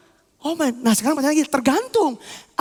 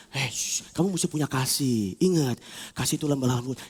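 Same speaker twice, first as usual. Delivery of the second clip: soft, low, soothing counsel; gentle admonishing; 12 semitones lower, 8 dB quieter than usual. Acted. Conscience urging forgiveness, gentle and low. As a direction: hush them, then counsel softly.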